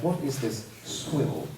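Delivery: softly voiced disdain; lightly acted haughty distaste; Sebastian. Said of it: "Sebastian would be like".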